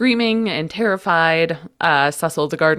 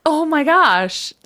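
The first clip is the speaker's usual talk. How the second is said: amazed; 9 semitones higher, 3 dB louder than usual. Real, not acted. Reacting to surprising news.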